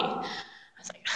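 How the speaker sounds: whisper. Acted. Whispered aside in retelling.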